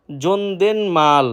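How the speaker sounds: জনদেনমাল drawn out, n before মাল; slow teaching demo of জনদেনমাল; very slow correct pronunciation. Shown as a demonstration. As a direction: say জনদেনমাল very slowly, syllable by syllable. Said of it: "সঠিক উচ্চারণ জনদেনমাল".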